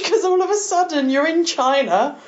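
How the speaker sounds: ordinary delivery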